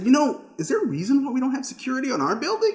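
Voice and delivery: high, quick mock-innocent query; puzzled question. Retelling his question to an employee, puzzled. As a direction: ask it with rising disbelief.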